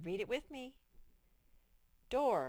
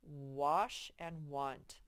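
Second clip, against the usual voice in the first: Wash and want stretched out slowly; slow sounding out; 3 semitones lower, 4 dB louder, much slower than usual. Shown as a demonstration. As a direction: model the vowel slowly for a child. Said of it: "it says ah".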